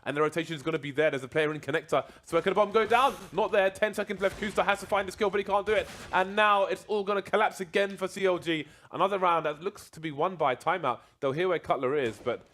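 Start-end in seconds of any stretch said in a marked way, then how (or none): 2.2-10.5 higher, louder rising hype; excited commentary. Real, not acted. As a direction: call the clutch moment with rising excitement. Round clock runs out, caster climax.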